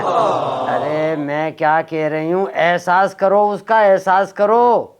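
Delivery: each word stretched out slowly; slow, deliberate. Acted. Wife on the phone stretching words for her deaf husband. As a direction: spell it out slowly for a deaf man.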